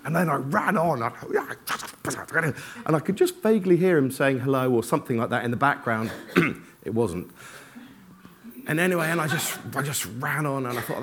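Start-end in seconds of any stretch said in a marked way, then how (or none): none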